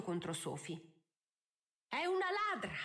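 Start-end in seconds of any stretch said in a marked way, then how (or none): 1.9-2.7 high-pitched angry cry; enraged accusation. A narrator's reading. Countess accusing Sophie of theft, enraged. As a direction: accuse her in high outrage. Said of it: "cominciò a tremare di rabbia".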